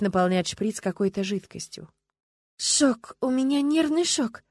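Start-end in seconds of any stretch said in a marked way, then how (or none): 2.6-4.4 high, slow tearful wail; drawn-out, high, weepy voice; sobbing. A narrator's reading. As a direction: whimper it out in shock. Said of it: "всхлипнула Люба".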